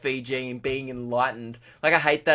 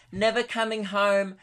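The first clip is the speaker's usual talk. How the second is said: lightly mocking, higher-pitched Instagram voice; smug travel braggart; 5 semitones higher than usual. Mocking friends posting from abroad, smug higher voice. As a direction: imitate a gloating holiday poster, pitched up. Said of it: "all over Instagram, they're like".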